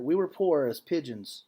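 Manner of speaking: normal talking voice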